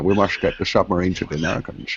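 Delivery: put-on lightly done Scottish accent, Connery style; light Scottish accent impression of Sean Connery; mock Sean Connery. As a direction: toss off a hint of Scottish brogue as Connery. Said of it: "he'll just speak in a Scottish accent".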